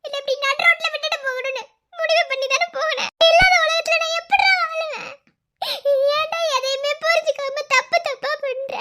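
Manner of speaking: high, loud, choked-up reproach; emotional friend scolding through tears; tearful scolding. Acted. Scolding friend after suicide attempt.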